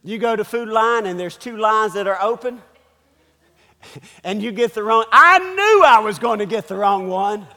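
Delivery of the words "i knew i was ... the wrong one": loud mock exasperation; hammed-up grumbling outburst; mad at being right. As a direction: burst out loudly on "I knew". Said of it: "you get mad over the fact".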